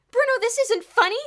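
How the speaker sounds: very high, loud upset scolding; upset exclaim. A narrator's reading. Diane upset with Bruno, exclaiming loudly. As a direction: snap at him in distress. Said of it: "Diane exclaimed, quite upset".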